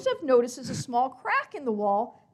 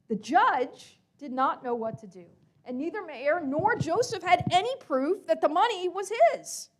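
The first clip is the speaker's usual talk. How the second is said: ordinary delivery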